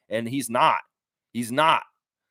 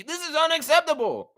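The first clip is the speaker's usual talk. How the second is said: higher, hammed-up parody of an upset fan; mock outraged fan; 11 semitones higher than usual. Streamer mocking chat's outrage over the loss, mock indignant. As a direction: toss off the fans' outrage as a joke. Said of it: "get all bent out of shape and be".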